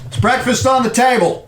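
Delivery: high, loud cheery dad call; dad's voice. Acted. Game master as dad calling his son to breakfast, cheery and loud. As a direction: call up to the kid like a chipper father.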